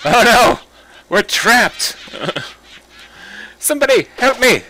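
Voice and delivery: hammed-up cry for help; mock panic. Player trapped, hammed-up panicked cry.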